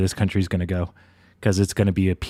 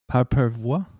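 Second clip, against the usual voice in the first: mock French accent; 3 semitones higher than usual. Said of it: "I can't do it".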